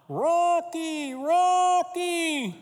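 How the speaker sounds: high, drawn-out call from far off; distant calling. Retold or imitated. Dog owner calling his lost dog, high and drawn out. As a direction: call the lost dog's name across the neighborhood. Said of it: "a voice calling".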